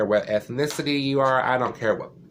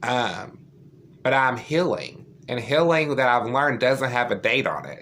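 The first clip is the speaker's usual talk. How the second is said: ordinary delivery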